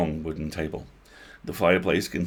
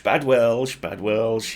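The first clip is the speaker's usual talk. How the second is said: bad Welsh accent; 3 semitones higher, 5 dB louder, much slower than usual. Shown as a demonstration.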